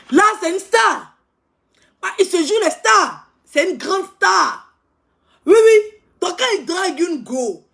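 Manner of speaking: high, loud, fast-paced gossip rant; ranting loud about a show-off; loud animated rant. Acted. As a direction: hype it up, loud and worked up.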